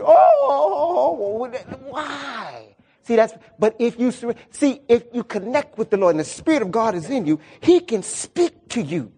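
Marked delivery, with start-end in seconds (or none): 0.0-2.7 mock wailing. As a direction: act out mourners crying without hope. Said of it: "We be like".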